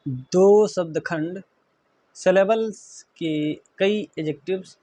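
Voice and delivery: drawn-out, word-by-word rule reading; slow dictation. Shown as a demonstration. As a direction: stretch दो and give each word its space. Teacher restates rule term for dictation.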